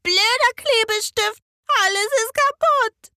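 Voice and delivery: put-on high, loud little girl yelling; loud child's wail about broken things; angry child shout. A narrator's reading. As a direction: let the small girl's fury burst out. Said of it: "ruft sie".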